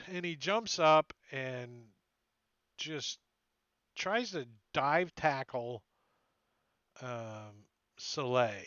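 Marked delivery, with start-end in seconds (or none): none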